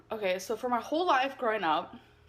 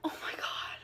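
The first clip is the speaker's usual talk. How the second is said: whispered awe; 11 dB quieter than usual. Retold or imitated. Whispered amazement.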